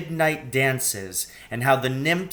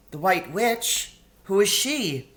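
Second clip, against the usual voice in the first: put-on puzzled girl Lucy; 7 semitones higher than usual. A narrator's reading.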